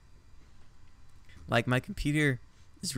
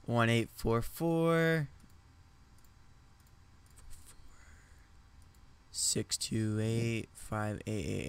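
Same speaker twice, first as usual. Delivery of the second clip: slow reading aloud; slower than usual. Real, not acted. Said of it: "reading number slowly".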